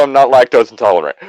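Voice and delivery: ordinary delivery, nothing marked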